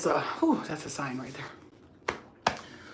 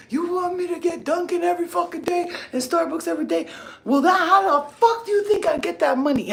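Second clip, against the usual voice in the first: scolding; 11 semitones higher, 4 dB louder than usual. Retold or imitated. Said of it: "Then I start saying to her".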